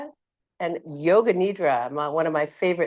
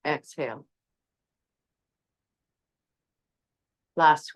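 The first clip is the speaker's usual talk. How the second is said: soft, lowered breath cues; 3 semitones lower, 4 dB quieter than usual. Real, not acted. Yoga teacher counting down the last breaths, soft and calm. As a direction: cue each breath quietly, leaving long pauses. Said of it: "my soft tone to you".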